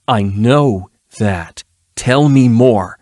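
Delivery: slow, deliberate threat; cold interrogator. Acted. Erilyn slowly presses her captive, cold and deliberate. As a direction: press him slowly and coldly.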